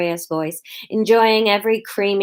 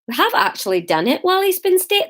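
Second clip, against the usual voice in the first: high, lilting Scottish attempt; Scottish accent; 10 semitones higher, faster than usual. Shown as a demonstration. Narrator hunting for Hamish's voice, high Scottish accent. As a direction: try the line in a Scottish lilt. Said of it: "Um, Scott, I".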